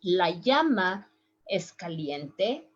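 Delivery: llama said as "yama"; first way. Shown as a demonstration. Teacher demos the first llama sound. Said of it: "three different sounds".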